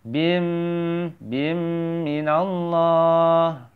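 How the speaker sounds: "bim" with a long nasal m into "minallah"; merged bim. Shown as a demonstration. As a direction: hum the m of "bim", then chant "minallah" slowly. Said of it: "masukkan binnya menjadi".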